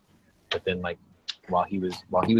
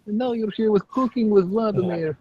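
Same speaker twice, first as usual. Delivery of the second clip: put-on Russian accent; 12 semitones higher than usual. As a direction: play a TV chef with a Russian accent. Cooking with Vladimir Russian persona.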